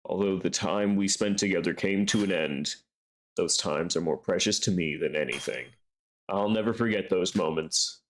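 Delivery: low-pitched, heartfelt confession; wistful, loving game character. Acted. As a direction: speak low, as in a tender farewell.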